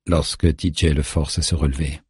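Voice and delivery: ordinary delivery, nothing marked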